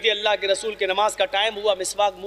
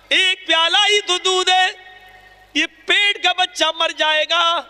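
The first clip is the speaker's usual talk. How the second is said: raised, fervent sermon voice driving the story; impassioned preaching; 7 semitones higher than usual. Acted. Preacher to his brothers, retelling the caliph's stand, fervent. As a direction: preach it with rising urgency.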